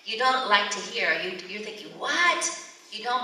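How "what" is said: high, startled outburst; shocked reaction. Acted. Parent hearing something unwelcome from a child, shocked. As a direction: blurt it in alarmed disbelief.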